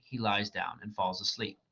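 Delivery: ordinary delivery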